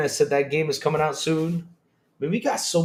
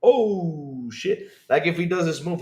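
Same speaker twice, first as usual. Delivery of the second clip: ordinary talk, nothing marked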